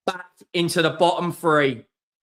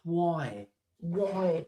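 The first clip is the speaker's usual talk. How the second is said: despairing why; 9 dB quieter than usual. Real, not acted. Despair after rival goal.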